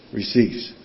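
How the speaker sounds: normal talking voice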